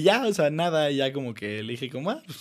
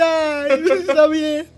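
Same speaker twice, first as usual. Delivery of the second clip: high, loud, whiny giving-in; mock tearful; 12 semitones higher, 7 dB louder, much slower than usual. Crying boy obeying the policeman, mock tearful. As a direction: wail a hammed-up reluctant okay.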